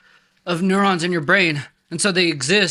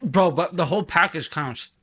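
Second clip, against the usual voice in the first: usual speaking voice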